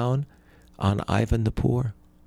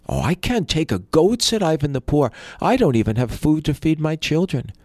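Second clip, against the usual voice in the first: higher, louder anxious refusal; high poor brother voice; 6 semitones higher, 3 dB louder than usual. A narrator's reading.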